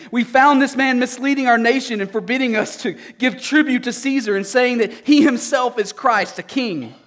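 scornful elders. Acted. Accusers before Pilate.

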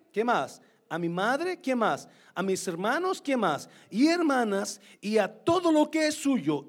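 loud, high fervent sermon reading; preaching scripture. Acted. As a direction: proclaim the verses with full force.

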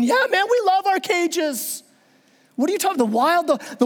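enthusiastic zoo animals. Acted. Hippo and giraffe backing the lion, enthusiastic. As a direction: chime in as contented cartoon animals.

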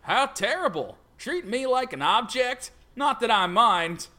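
lightly voiced Louisiana hillbilly; mock Louisiana hillbilly teasing. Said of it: "a Louisiana hillbilly voice".

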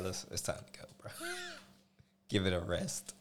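whispered nudge to leave the gym; hushed urging. Retold or imitated. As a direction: hiss it like pulling a friend away. Friend dragging him from the gym.

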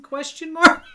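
high, loud, mock-quizzical squeak; jokey high-pitched tag question. Mocking rhetorical question.

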